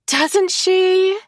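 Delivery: high sugary sarcastic coo; mock-sweet sarcastic coo. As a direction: coo it sweetly with a sneer underneath. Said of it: "she coos sarcastically".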